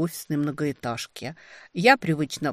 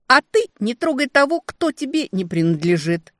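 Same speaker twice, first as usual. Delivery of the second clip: loud, higher snarling retort; growling back; 6 semitones higher, 5 dB louder than usual. A narrator's reading. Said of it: "Я тоже рычать умею". (громкий рык в ответ, выше)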